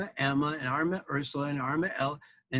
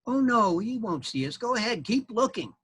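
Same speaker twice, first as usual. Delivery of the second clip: high eager critter voice; armadillo mama; 8 semitones higher than usual. Acted. Armadillo mama urging the knight to keep looking. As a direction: coax him on.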